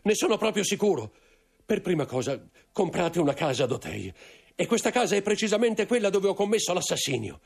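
sfogo acuto e concitato; confessione angosciata. A narrator's reading. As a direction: incalza con terrore, la voce molto alta. Intendente sconvolto confessa l'assassinio. (sfogo angosciato, voce acuta)

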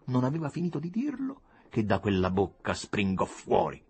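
ordinary delivery

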